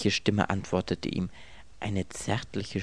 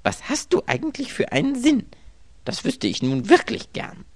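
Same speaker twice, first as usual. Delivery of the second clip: high, louder reproach; frustrated writer berating his typewriter; annoyed scolding; 7 semitones higher, 4 dB louder than usual. A narrator's reading. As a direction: tell off the typewriter.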